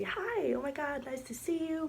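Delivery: high, gushing hello; bubbly high hello; excited greeting. Retold or imitated. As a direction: squeal a delighted hi to a friend.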